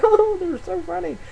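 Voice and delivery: put-on high, giggly old grandmother; mock granny voice; senile grandma. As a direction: coo like a sweet senile granny. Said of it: "she was like".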